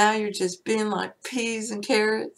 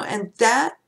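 normal talking voice

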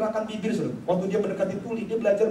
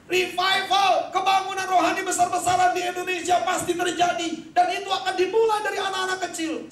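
high, loud rallying shout; booming revival declaration, pitched high; preacher's proclamation; 11 semitones higher, 4 dB louder than usual. Retold or imitated. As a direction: proclaim it at full force, drawing out the first word. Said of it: "Saya bilang begini".

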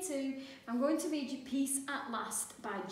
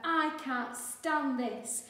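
fed up; 4 semitones higher, 6 dB louder, much slower than usual. A narrator's reading. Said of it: "said Mister Bear".

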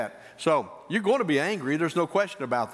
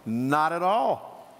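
slow emphatic; much slower than usual. Shown as a demonstration.

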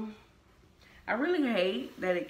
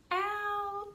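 out jumps way up; high sign-off; 14 semitones higher than usual. Pretend. Signing off the vlog with a high squeak. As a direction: pop the last word high. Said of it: "And I am".

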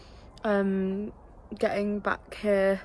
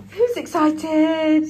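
excited; 6 semitones higher, 10 dB louder, much slower than usual. Real, not acted. Hyping up the dog.

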